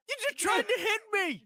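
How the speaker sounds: high, loud mock indignation; indignant disbelief. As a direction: squeal it like you just got beaned. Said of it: "And you're like".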